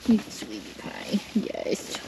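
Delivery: soft whispered endearments; whispered pet talk; tender whisper. Real, not acted. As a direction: whisper lovingly to your sweet goat. Cuddling her favourite goat.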